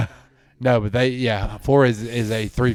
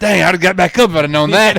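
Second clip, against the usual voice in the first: higher, louder mock gasping voice; imitating a winded quarterback; 7 semitones higher, 8 dB louder than usual. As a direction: play the dazed, breathless quarterback, hammed up. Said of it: "He said".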